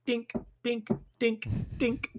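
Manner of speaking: high, light dink noises; ping each dink off the hull; dink sounds. Retold or imitated. Cannonballs bouncing off the battleship, tinny dinks.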